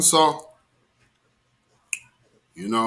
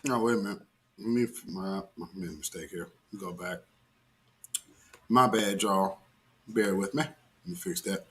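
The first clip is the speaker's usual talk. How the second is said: much softer, low muttered aside; soft apologetic aside to viewers; quiet aside; 7 semitones lower, 10 dB quieter than usual. Real, not acted. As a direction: mutter to yourself while fixing it. Aside fixing a playback mistake.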